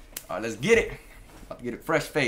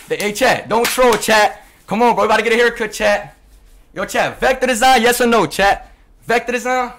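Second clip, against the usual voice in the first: loud call to the chat; loud hype shouting; 7 semitones higher, 11 dB louder than usual. Real, not acted. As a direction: call out to the viewers. Shouting at chat to stop trolling.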